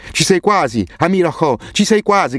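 grido acuto ed eccitato, col fiato corto; breathless shout. A narrator's reading. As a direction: incita l'amico gridando, senza fiato. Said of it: "gridò Hassan ansimando". (Hassan incita Amir: grido acuto e ansimante)

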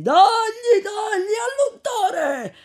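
high, loud, drawn-out mob cry; mob yelling. Acted. Fleeing enemies shouting at Renzo, furious mob cry. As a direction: hurl the accusation after the cart. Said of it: "non lasciando di gridare".

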